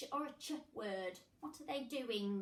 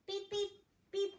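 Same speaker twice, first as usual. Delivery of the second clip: machine beeps; 8 semitones higher than usual. Pretend.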